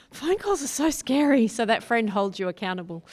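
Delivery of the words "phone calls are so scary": high, loud mock panic; mock scared. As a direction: squeal it like phone calls terrify you. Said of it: "I'm like".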